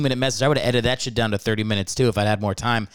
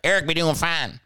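imitated Cajun teacher, pitched up; Cajun accent; 4 semitones higher than usual. Cajun teacher reporting to a pupil's dad, imitated Cajun accent. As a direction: put on a higher Cajun teacher voice. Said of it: "I had a Cajun teacher in second grade".

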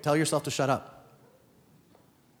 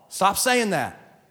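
slow emphatic; 4 semitones higher, much slower than usual. Shown as a demonstration. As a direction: stop saying that drawn out slowly. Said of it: "No, you're not".

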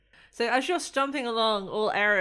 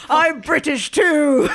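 mock British; 6 semitones higher, 11 dB louder, slower than usual. Player mimicking British character.

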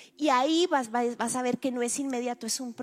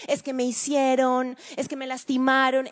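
mock self-pitying complaint, louder; whiny victim; 3 semitones higher, 7 dB louder than usual. Preacher mocking a victim's complaint, whiny. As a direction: play the wounded victim whining.

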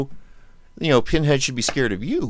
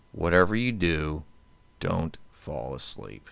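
slow, softer ominous line; slow creepy warning; 6 semitones lower, 5 dB quieter, slower than usual. Retold or imitated. Host quoting Nightmare on Elm Street for cohost, slow and spooky. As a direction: warn them slowly, spooky.

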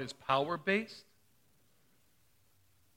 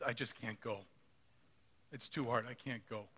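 grieving mother; 4 semitones lower, 10 dB quieter than usual. Retold or imitated.

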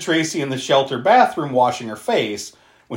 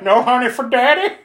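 high, raised mock-creepy dad voice; hammed-up wheedling question, pitched way up; creepy father; 11 semitones higher, 6 dB louder than usual. Mimicking the disfigured dad taunting his daughter, creepy mock voice. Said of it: "He's like".

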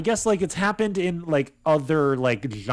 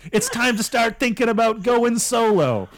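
high, loud New York band manager; hammy New York showbiz manager; 8 semitones higher, 4 dB louder than usual. Acted. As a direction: pitch the big plan in a New York accent. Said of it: "the New York voice".

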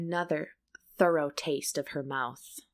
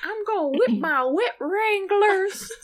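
put-on old prospector; 13 semitones higher, 5 dB louder than usual. Old man voice game continues.

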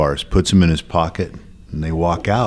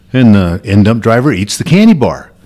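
normal talking voice

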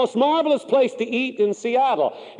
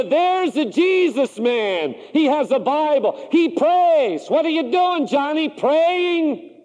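higher, slow, mocking taunt; sneering ridicule; 7 semitones higher, slower than usual. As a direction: jeer at him as barracks mates would. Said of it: "face up to a sneer, to the ridicule".

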